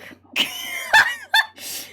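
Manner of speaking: big burst of laughter; loud laugh. Real, not acted. Laughing about getting drunk.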